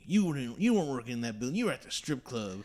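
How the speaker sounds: loud high mock of the accusing tow driver; old southern tow guy. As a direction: play the indignant old tow truck guy. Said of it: "He's like".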